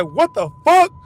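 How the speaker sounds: sudden high, loud "what the"; jolted awake and shocked; shocked outburst. Retold or imitated. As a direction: blurt it out in disbelief. Retold shock on waking up.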